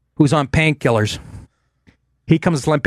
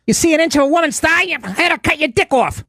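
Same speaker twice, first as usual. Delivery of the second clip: put-on Jersey Italian accent; 13 semitones higher than usual. Quoting Carmela scolding Tony, Jersey accent. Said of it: "That nice Italian accent, that Jersey accent".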